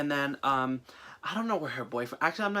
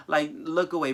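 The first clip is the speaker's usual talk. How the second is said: ordinary talk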